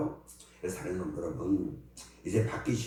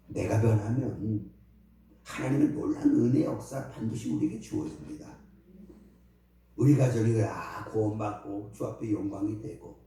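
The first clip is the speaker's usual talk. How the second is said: prayer; 5 dB louder than usual. Acted. Pastor continues prayer. (목사가 회중을 위해 드리는 엄숙한 기도)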